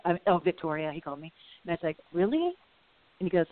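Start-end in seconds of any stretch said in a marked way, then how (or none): 2.1-2.5 high incredulous rise; imitating her own surprise; surprised. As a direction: ask it with mock disbelief, pitched much higher. Surprised retort in retold exchange.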